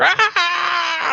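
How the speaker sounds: very high short yelp of pain; mock scream. Retelling the nitrogen spray, a very high mock yelp. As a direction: yelp as if hit by freezing spray. Wes frozen by liquid nitrogen.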